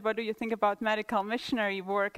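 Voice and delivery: ordinary talk, nothing marked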